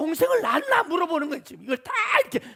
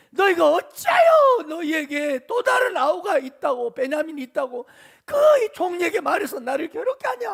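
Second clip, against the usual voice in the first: irritated scolding; 4 semitones higher, 6 dB louder than usual. Retold or imitated. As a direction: scold your sons with mock exasperation. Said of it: "이 자식들한테 막 짜증을 내는 겁니다. 야단칩니다".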